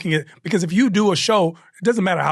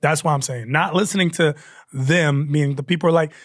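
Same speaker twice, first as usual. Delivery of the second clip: normal talking voice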